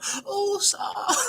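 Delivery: very high mock scream of a name; Nami screaming. Retelling, Nami calls to the fallen Usopp, very high mock scream. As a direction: shriek his name like she's losing him. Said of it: "crying hysterically and screaming out".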